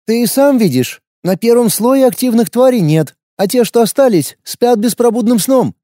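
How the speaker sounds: wary fighter, blunt and guarded; young warrior woman. A narrator's reading.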